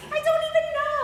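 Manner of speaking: high, loud exasperated denial; exasperated outburst. Acted. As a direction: blurt it out, loud and baffled. Exasperated denial in acted story.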